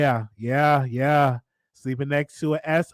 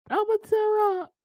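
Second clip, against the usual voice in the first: mock crying. Host hams up crying again.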